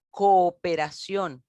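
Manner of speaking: COOPERACIÓN slowly, with both O's sounded; vowels separated. Shown as a demonstration. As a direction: stretch the start of "cooperación" as co-o. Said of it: "pero separadas".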